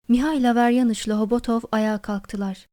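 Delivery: ordinary delivery, nothing marked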